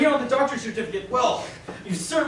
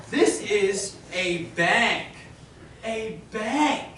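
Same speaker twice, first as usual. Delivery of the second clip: slow patronizing; 3 semitones lower, much slower than usual. Acted. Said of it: "listen to me carefully".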